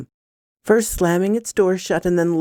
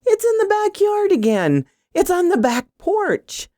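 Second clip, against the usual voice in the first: very high, panicked cry; narrated scream. A narrator's reading. Woman telling her boyfriend the gnome is back, panicked scream. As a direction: shriek it high without much added volume. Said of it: "I screamed".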